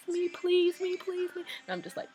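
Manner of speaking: high, loud pleading for the towel; eager begging. Retold or imitated. Fan retelling begging Harry for his towel, high and eager. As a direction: beg like a desperate fan, way up high.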